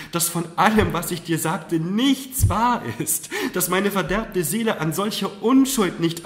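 high, loud, fervent outpouring; impassioned confession. A narrator's reading. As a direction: pour out remorse and adoration. Mother confesses to daughter in remorse.

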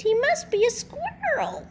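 peeping smallest chick. A narrator's reading. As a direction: peep it with silly certainty. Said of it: "she peeped".

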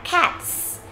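Cats with a soft breathy ts at the end; Cats, barely aspirated, ending on ts; Cats, âm thờ rất nhẹ; light ts ending. Shown as a demonstration. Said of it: "âm thờ này rất là nhẹ thôi".

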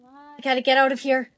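ordinary delivery

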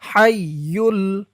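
হাইয়ুল with a throaty Arabic h, slow and clear; careful pronunciation. Shown as a demonstration. Teacher tells students to notice how হাইয়ুল sounds. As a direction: pronounce হাইয়ুল slowly with the Arabic h. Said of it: "উচ্চারণটা খেয়াল করুন".

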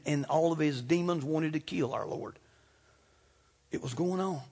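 ordinary talk

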